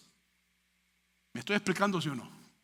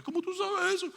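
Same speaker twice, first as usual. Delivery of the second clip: much higher, caught-off-guard question; startled disbelief; 11 semitones higher than usual. Retold or imitated. Counseled person shocked the preacher knows their secret, much higher. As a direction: gasp out the question in surprise. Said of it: "y la persona".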